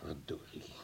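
hushed, breathy curse under the breath; whispered curse. Acted. Official whispers a curse after the professor leaves. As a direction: swear to yourself so nobody hears.

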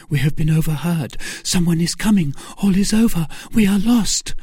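high, urgent alarm, climbing as it goes. A narrator's reading. Milady warns Felton someone is coming, high and alarmed. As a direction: warn him urgently, pitch rising with each phrase.

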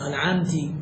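أنعمتي ending on a long i, soft; wrong kasra. Shown as a demonstration. Teacher repeating the student's wrong kasra ending. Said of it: "لحن جلي".

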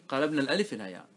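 normal talking voice

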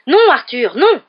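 high, loud cry of protest; screaming. A narrator's reading. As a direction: scream at him to stop. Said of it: "se mit à hurler". (hurlement de protestation)

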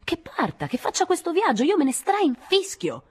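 very high, heated cry; high-pitched defiant outburst; angry yelling. A narrator's reading. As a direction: shout defiantly, dragging out the last words. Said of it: "continuò a gridare".

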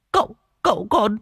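put-on high, loud boy stammering in shock; the boy chokes out the news; stammering servant boy. Servant boy stammers to master that son died, panicked. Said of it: "Thằng Tèo lắp bắp".

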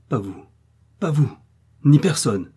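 refus sec et fort; sévère. A narrator's reading. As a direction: refuser sans appel. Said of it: "dit l'hôtesse d'un air sévère". (l'hôtesse refuse sévèrement l'entrée à Millicent)

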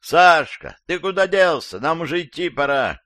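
high, loud calling shout. A narrator's reading. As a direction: yell up the stairwell to a friend. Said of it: "кто-то громко крикнул".

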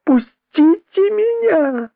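very high shrieking cry; hysterical scream. A narrator's reading. Widow trying to get at the traitor, shrieking. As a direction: scream it wildly. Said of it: "истошный крик вдовы".

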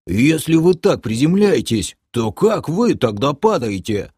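honking, disgruntled question; nasal grumble. A narrator's reading. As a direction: grumble it through the nose. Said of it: "прогундел Крей".

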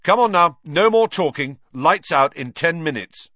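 high, loud prefect's order; shouting. A narrator's reading. Percy ordering the whole hall to bed, shouting. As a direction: bark the order across the hall. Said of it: "shouted Percy".

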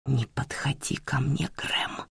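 hissed whisper; whispered fury. Acted. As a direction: hiss it under your breath. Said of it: "прошипела она".

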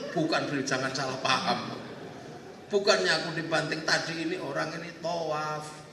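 low, subdued reassurance; calm explaining. Acted. Prophet clearing up a misunderstanding with Gabriel, calm. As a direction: settle into a low, patient account.

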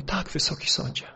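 soft, hesitant retold reply; unsure answer. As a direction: lightly imitate a witness answering quietly and unsurely. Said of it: "taka niepewność w jego głosie". (cicha, niepewna odpowiedź)

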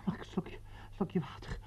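soft, pleading whisper for water; thirsty player whispering a plea for water; begging whisper. Acted. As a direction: whisper as if parched.

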